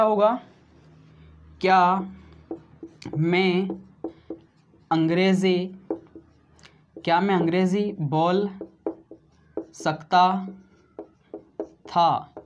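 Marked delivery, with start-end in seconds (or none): 1.6-12.3 word by word with long pauses; dictating slowly to students; slow dictation. Shown as a demonstration. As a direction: say each word separately for notes. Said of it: "क्या बना दोस्तों?".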